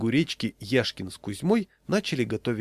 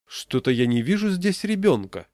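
higher, louder pretend surprise; feigned puzzlement; 6 semitones higher, 5 dB louder than usual. A narrator's reading. Yashkin teasing parents about the child, feigned puzzlement. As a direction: play at not noticing. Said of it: "притворно сказал Яшкин".

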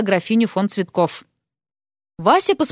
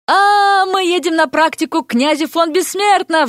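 excited shout; 6 semitones higher than usual. A narrator's reading. Student bursting into the exam with news, shouting excitedly. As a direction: burst in crying out the great news. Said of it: "закричал".

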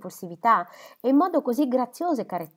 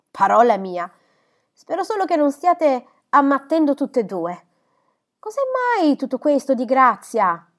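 astonished scold; 6 semitones higher, 8 dB louder than usual. Acted. Stepmother astonished at the waltzing.

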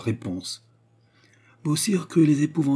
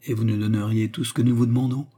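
ordinary delivery

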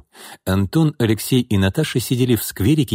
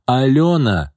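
higher-pitched, anxious call; worried call; 6 semitones higher, 4 dB louder, slower than usual. A narrator's reading. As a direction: uncle calling out to a child at risk. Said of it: "забеспокоился Антон". (встревоженный оклик)

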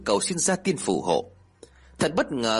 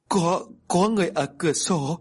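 put-on higher, stammering scared little boy; frightened child; 5 semitones higher than usual. A narrator's reading. Frightened grandchild telling grandma someone is at the window, scared. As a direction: point it out in fear, voice raised. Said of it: "đột nhiên cả hai đứa ngồi bật dậy khóc ré cả lên".

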